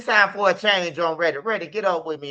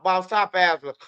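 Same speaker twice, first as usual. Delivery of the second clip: ordinary talk, nothing marked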